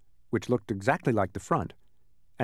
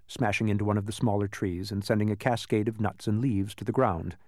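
plain reading voice

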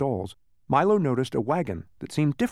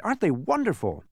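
high, excited call; excited cry; 6 semitones higher than usual. Acted. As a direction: call out in delight. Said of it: "he cried".

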